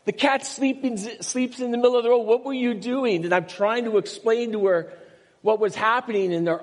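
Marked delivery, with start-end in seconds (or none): 0.1-3.2 high-pitched mock yell of an upset mother; pitched-up retold outburst, half-shouted. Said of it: "she's yelling".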